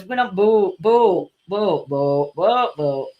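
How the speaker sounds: "boat" with a long, pure O and barely any T; broad northern long-O "boat", repeated; lengthened O's. Shown as a demonstration. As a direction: draw out the O in each "boat". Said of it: "Just lengthen the O's".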